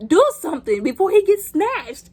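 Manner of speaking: loud, high plea to act; worked-up alarm; urgent exclamation. Acted. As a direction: yell at the characters to help him. Urging characters during the attack.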